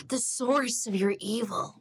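put-on American accent with a very hard R in SOURCE; exaggerated rhotic American line reading; overdone American accent. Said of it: "my R is so hard".

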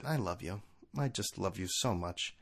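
soft, slow, low declaration of love; hushed, heartfelt murmur; tender. Retold or imitated. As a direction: say it softly and adoringly. Tender words to his wife.

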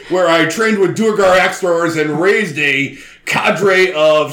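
conspiracy radio host. Acted. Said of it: "I can't believe you're playing this character".

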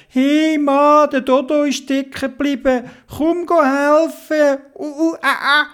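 high strained heaving voice; Dodo straining to pull. Acted. Dodo pulls Theobald from the mud, high and straining. Dodo asks man for help.

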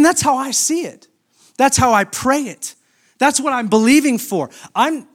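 high, impassioned preaching voice; preaching at full force. Acted. Pastor urging congregation to believe, full impassioned preaching. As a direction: lift it up and preach with fervor.

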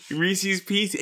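Reese's Pieces with both ending in -eez, pitched high; pronounced REE-seez PEE-seez. Shown as a demonstration. Realizing their own habit, Reese's Pieces with -eez endings. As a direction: say Reese's Pieces so it rhymes with easy. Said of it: "I always say Pieces".

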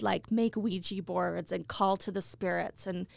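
ordinary delivery

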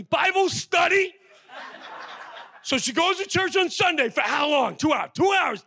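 outraged friend. Retold or imitated. Friend yelling at Brewer that his wife is in a cult, incredulous shouting. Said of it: "I had friends going".

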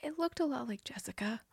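secret whisper to her husband; breathless hushed aside. Acted. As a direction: whisper it so nobody overhears. Hushed warning to spouse.

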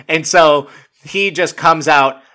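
ordinary delivery

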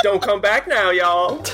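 put-on Southern accent. GM joins Southern mock.

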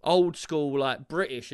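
normal talking voice

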